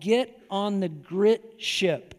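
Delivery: slow it right down so "grit ship" stays clear; said very slowly. Shown as a demonstration. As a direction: get on the grit ship, each word drawn out slowly. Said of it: "you need to say that very slowly".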